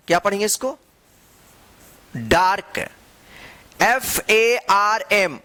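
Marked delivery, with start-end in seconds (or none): 3.8-5.4 each letter drawn out slowly; slow spelling out. Shown as a demonstration. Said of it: "farm.". (अक्षर-अक्षर धीमे से बोलना)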